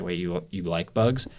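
halting, mildly condescending disbelief. Retold or imitated. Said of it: "condescending in the way that I just kinda judged him".

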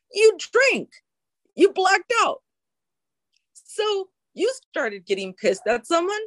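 imitated coach's high-pitched, insistent telling-off; emphatic lecturing. Sober coach lectures client on drinking away feelings, emphatic and high. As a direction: drive each point home, pitch jumping up.

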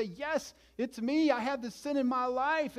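high, loud, pleading cry of confession. Acted. A sinner confessing to God in a high, loud plea. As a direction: own it out loud like a broken sinner.

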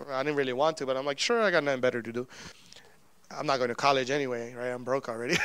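low, soft, grudging agreement; resigned. Retold or imitated. Broke student agreeing to translate, resigned. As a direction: give in quietly with low energy.

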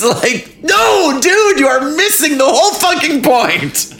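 incredulous, pitch shooting up; high exasperated protest. Real, not acted. Exasperated rant at character.

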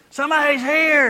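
put-on high-pitched child's announcement; little kid calling out. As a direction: call it out like a kid at the window. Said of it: "A little kid looking through the window going".

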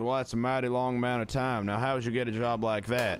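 country Southern accent, playing along; hammed-up Southern accent; put-on Southern accent. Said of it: "I'm stuck in this accent now".

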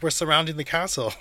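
mock call back from a distance; retold calling back. Retells German calling back.

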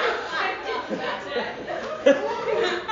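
high, voiceless wheezy laugh. Real, not acted. Preacher cracks up at his own example, long laugh. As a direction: laugh helplessly for a few seconds.

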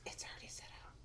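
breathy whisper. Real, not acted. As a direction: whisper it back. Whispered reply.

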